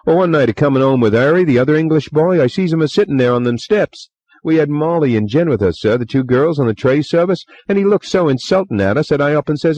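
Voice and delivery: put-on chatty young bellboy, eager to please; Cockney bellboy. A narrator's reading. Bellboy Thomas voiced.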